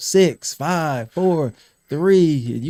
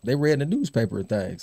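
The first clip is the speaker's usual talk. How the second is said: ordinary talk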